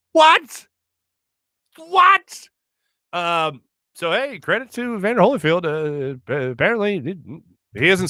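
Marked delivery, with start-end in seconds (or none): 0.1-2.5 high, louder disbelieving what; stunned what. Real, not acted. Host reliving Tyson biting again, stunned what. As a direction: cry out in disbelief. Reliving second ear bite.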